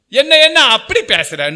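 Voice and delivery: high, loud mock comeback; mock retort. Preacher acting a quarrelling retort, mock loud comeback. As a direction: fire back at the accuser, hammed-up. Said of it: "சொல்லி out".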